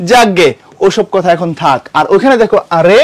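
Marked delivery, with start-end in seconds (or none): 2.8-3.0 sudden high, loud astonished cry; surprise. Shown as a demonstration. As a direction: act startled by someone showing up. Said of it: "ওটা অবাক হওয়ার আবেগ".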